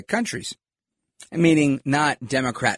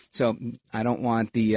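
usual speaking voice

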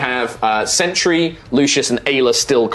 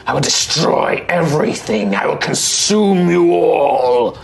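menacing monster threat; slower than usual. Acted. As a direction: growl it as a dark creature. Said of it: "It's like".

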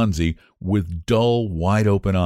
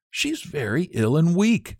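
put-on young Sue, worried; 10 semitones higher than usual. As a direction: plead her friend's case, pitched up. Sue's voice in the story.

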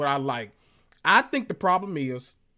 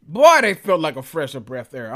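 louder mock repeat of "a fresher breath air", leaning on "Boy"; loud repeat of Teresa's phrase; 8 dB louder than usual. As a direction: belt out "Boy, they felt like a fresher breath air" in playful mockery. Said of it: "What Teresa be saying".

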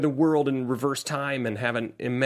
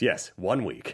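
low, softer mock-villain reply; villain's solemn confirmation; 8 semitones lower, 5 dB quieter, much slower than usual. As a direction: confirm it gravely, like the movie villain. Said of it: "And then he goes".

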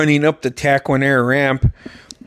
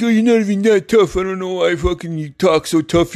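mock taunt; 4 semitones higher than usual. Taunting opponent in a dream fight.

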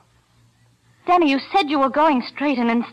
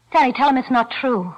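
ordinary talk

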